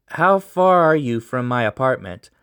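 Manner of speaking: usual speaking voice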